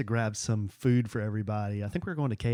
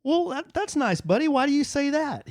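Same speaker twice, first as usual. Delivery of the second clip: put-on high, gentle parent talking to a child; sweetly puzzled dad, pitched way up; dad to little boy; 12 semitones higher than usual. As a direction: coo it to a small son. Dad voiced talking to young son.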